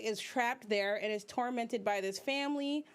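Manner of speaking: ordinary talk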